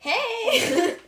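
high shrieking "Hey!" with a laugh; crazy woods lady. Retold or imitated. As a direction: call out like a wild hermit lady. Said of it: "comes out and be like".

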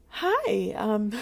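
high, loud awkward hello; nervous greeting. Retold or imitated. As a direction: act out an over-eager, nervous greeting. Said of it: "Like, I'm nervous".

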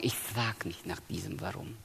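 whispered, soft quote of a humble believer; hushed quote. Retold or imitated. Preacher quoting Christians who never ask why, whispered. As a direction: breathe it out, almost unvoiced. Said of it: "wenn Christen den Mut haben zu sagen".

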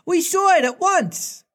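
very high excited cries; boys crying out. Acted. Lost boys cheer Wendy, very high excited cry. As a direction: squeal it like delighted boys. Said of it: "they all cried".

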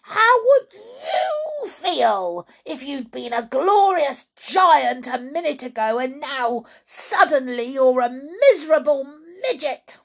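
outraged high-pitched wailing rant; furious cry. A narrator's reading. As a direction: rage at them, stretching the words. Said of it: "she cried".

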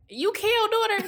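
high, slow excited recognition; imitating someone at the liquor store, pitched high; strangers recognizing her. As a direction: call it out like a stranger who just recognized her. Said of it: "they be like".

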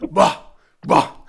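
loud, drawn-out presidential "bom"; Marcelo impression. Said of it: "eu só consigo imitar o bom".

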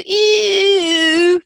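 long, loud, high mock 'ew'; drawn-out playful yuck; disgusted squeal. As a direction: squeal like a grossed-out kid. Kids' disgust at hugging imitated.

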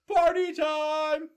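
very high, slow party yell; crowd shout. A narrator's reading. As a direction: stretch it out like a cheering crowd. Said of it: "the crowd shouted".

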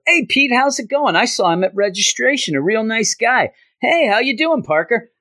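high, chummy acted hello; friendly classmates greeting. Classmates greeting Peter in class, high-pitched imitated friendliness. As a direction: imitate students hailing a new classmate. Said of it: "People are like".

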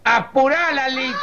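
mock yell from inside, impatient; wife yelling from inside. Wife hurrying husband at the grill, mock yell. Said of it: "la mujer le grita de adentro".

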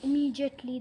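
ordinary delivery

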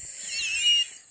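real squealing laugh; high burst of laughter. Real, not acted. After a silly Jesus joke, a real high laugh. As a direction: crack up at her own joke. Cracks herself up.

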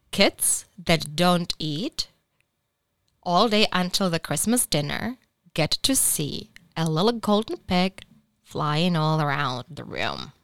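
usual speaking voice

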